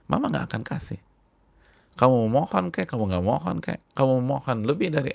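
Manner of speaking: stern mother. Retold or imitated. Preacher voicing a mother refusing her whining child, stern and loud. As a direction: lay down the law to a whining child, rising to a firm no.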